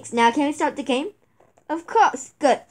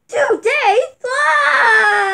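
wailing in dismay; crying wail; 7 semitones higher, much slower than usual. Acted. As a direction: howl like a crushed kid. Wail again.

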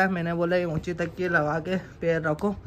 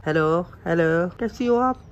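ordinary talk, nothing marked

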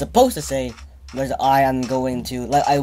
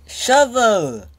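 pitched up and louder; quick excited yelp; sudden high word; 9 semitones higher, 5 dB louder than usual. Real, not acted. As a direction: blurt it out brightly. Sudden high exclaim on item.

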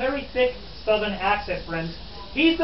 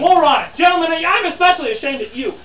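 raised, higher voice of feigned outrage; mock scolding; 5 semitones higher, 5 dB louder than usual. Guide shaming the gentlemen in the crowd, mock indignant. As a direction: berate them theatrically.

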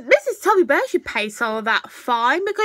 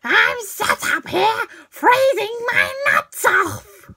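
put-on little boy character; 6 semitones higher than usual. Acting out a freezing boy for viewers, little boy voice. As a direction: whine it high like a little boy.

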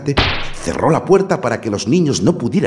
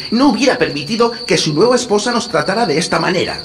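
put-on boy voice=44; 13 semitones higher, 4 dB louder than usual. A narrator's reading. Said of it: "dijo Hansel".

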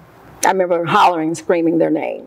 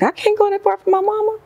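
high pleading protest; 13 semitones higher than usual. Retold or imitated. Protest at moving.

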